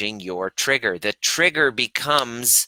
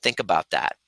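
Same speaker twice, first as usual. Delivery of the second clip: normal talking voice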